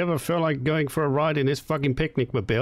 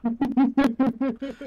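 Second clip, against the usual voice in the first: sudden high burst of laughter; 12 semitones higher than usual. Real, not acted. Man cracking up at auntie's crude joke, high laugh.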